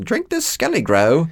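high-pitched matron impression; put-on high nurse voice; Madam Pomfrey. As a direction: pipe it up like a hospital matron. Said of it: "Madam Pomfrey comes in and says".

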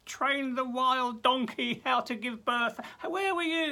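high, loud, booming challenge; God challenging Job from the storm. Retold or imitated. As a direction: thunder down at Job. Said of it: "You know".

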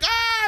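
high loud startled yelp; mock bunny yell. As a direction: let out a high, loud cartoon shriek. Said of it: "the Easter Bunny's like".

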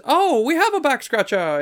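high-pitched playful announcement; hammy imitation of the cook; kitchen lady giving in. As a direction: sing it out high, playing along. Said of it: "And she goes".

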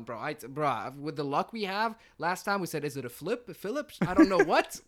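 ordinary talk, nothing marked

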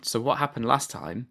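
usual speaking voice

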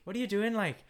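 a hint of Irish brogue, teasing; Irish accent. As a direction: toss off a light Irish imitation. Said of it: "And they say".

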